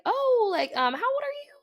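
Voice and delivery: high, loud imitation of the driver; hammed-up creepy driver asking her age; mock creepy driver. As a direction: ask it in a squeaky fake-friendly voice. Voicing another driver.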